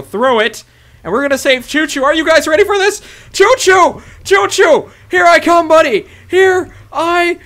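high, loud battle cry; heroic rallying shout. Real, not acted. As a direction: yell it as you charge in. Charging in to save Choo Choo.

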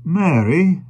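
high, loud call; alarmed exclamation. A narrator's reading. Carrier holding his wife in his arms, alarmed cry. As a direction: call her name in alarm. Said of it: "exclaimed the carrier".

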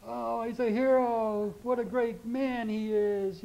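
high, slow, drawn-out gushing; hammed-up hero worship; mock admiration. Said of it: "what do you say?".

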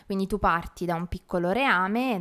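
ordinary talk

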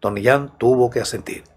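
usual speaking voice

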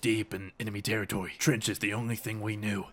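dramatic war narrator. Acted.

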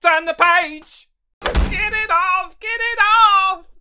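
high, loud and slow terrified shrieks; panicked screaming. Acted. Character screaming at the reader to get it off. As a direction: scream for help in full panic.

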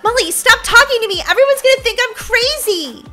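high, quick, agitated outburst; exasperated scolding. Acted. Brookie begs the ghost to hush, exasperated scolding. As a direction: tell the voice off in a hurry.

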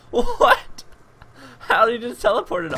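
high, loud disbelieving squeal; shocked. Real, not acted. Gamer yelping at a teleporting enemy, high and loud. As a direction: yelp it out in shock.

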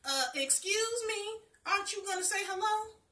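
a grumpy talking tree demanding a greeting. Acted. Tree calls down to the girl, high and loud. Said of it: "nearly jumped out of my skin when I heard".

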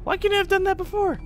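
high, incredulous complaint; exasperated squeak. Real, not acted. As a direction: squeal out the frustration. Frustrated at repeated move.

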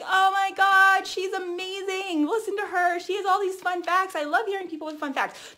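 high, gushing, slowed fan voice. Pretend. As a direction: rave like an amazed follower. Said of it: "They're gonna be like".

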